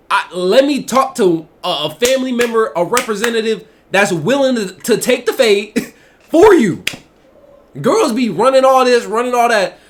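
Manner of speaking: loud, high-pitched challenge; heated rant. Acted. Streamer calling out for someone to fight instead, loud heated rant. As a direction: demand it loudly, pitch riding high.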